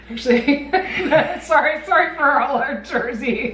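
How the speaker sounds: giggling through a sheepish sorry; laughing as she apologizes to Jersey; laughing apology. Real, not acted. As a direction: apologize while cracking up. Apologizing to New Jersey listeners.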